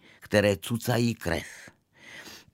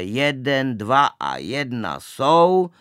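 loud, higher, drawn-out count; slow shouted count, stretching the words; called-out countdown; 4 semitones higher, 6 dB louder, slower than usual. A narrator's reading. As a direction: call out the count before a jump. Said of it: "zvolal podruhé".

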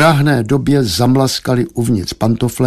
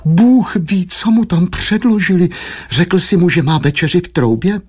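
high, fretful lament; plaintive high-pitched worrying; moaning wife; 7 semitones higher than usual. A narrator's reading. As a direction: fret aloud in a raised voice. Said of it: "zasténala paní Štědrá".

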